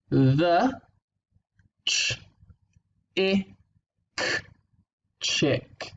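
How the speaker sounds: letter by letter blending; sounding out. Shown as a demonstration. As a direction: segment each sound then blend. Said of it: "so this sentence here".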